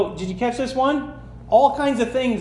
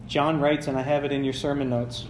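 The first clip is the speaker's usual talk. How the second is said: usual speaking voice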